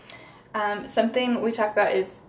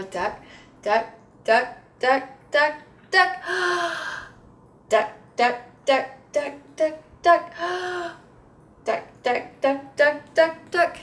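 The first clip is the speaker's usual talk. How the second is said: building excited repetition; 7 semitones higher, slower than usual. Shown as a demonstration. Therapist building excitement with repeated duck for kids. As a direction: build the ducks up with gasps between. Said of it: "I'm taking a deep breath in between each thing".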